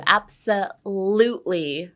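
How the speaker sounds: 'Absolutely' slowly, leaning on the 'lu'; stress on LU. Shown as a demonstration. Teacher showing learners the emphasis, 'Absolutely' stressed on LU. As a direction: draw out and stress 'lute' in 'Absolutely'. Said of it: "Emphasis on the L U".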